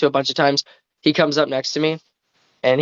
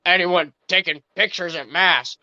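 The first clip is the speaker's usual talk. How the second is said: slow, indignant usher impression; imitated raised voice; 3 semitones higher, slower than usual. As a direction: draw it out as a scandalized old churchgoer. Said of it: "raises his voice".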